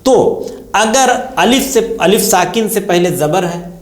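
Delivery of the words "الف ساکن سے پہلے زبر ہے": usual speaking voice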